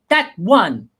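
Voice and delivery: lightly imitated little boy counting a warning; mock-stern child's warning; child copying parent. Son giving parents a timeout warning, mock-stern. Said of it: "He'll go".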